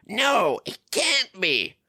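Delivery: high, slow dismay; dramatic villain gasp. Retold or imitated. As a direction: ham up the villain's shock.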